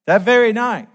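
That very night, shouted high and loud; loud proclamation. Real, not acted. Preacher proclaiming the king's doom to the congregation, loud and high. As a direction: ring out "That very night" like a verdict. Repeated for emphasis.

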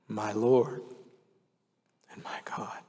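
a hushed, fading whisper of awe; awed whisper. Acted. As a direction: breathe it out in wonder. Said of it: "And Thomas said".

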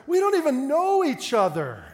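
high, loud mock exclamation; exasperated disbelief. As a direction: act out incredulous exasperation. Acted exasperated inner reaction to caller.

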